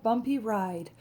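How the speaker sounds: ordinary delivery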